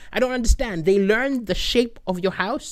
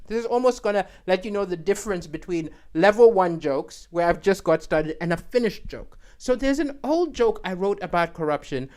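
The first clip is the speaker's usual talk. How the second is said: normal talking voice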